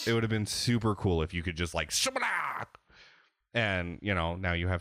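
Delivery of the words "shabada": sword slash sound effect. Retold or imitated. Mimics sword slicing sound.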